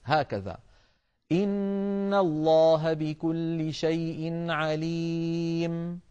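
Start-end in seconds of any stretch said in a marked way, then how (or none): 1.3-6.0 slow chant, a short silent stop without a breath before براءة; recitation with a brief pause. Shown as a demonstration. Teacher demonstrating the breathless pause between suras. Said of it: "أن نسكت يعني بدون تنفس".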